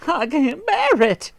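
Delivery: high, slow, anguished wail; wife crying out. A narrator's reading. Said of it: "cried Miss Twit".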